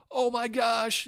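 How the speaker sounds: high tearful gasp of joy; brother crying. Retold or imitated. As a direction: imitate a brother in happy tears. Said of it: "He goes, crying already".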